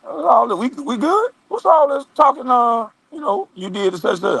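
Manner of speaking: high-pitched, imitated casual confrontation; mock confronting. Calling the man back about his talk, high mock confronting.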